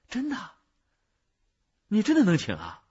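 高声惊问，半信半疑; 拔高音调，急着确认; 惊喜追问. Acted. 听说能请来，惊喜追问. (又惊又喜地追问)

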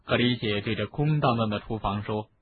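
usual speaking voice

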